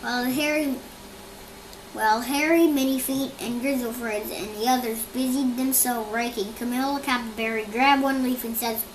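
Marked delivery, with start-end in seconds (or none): none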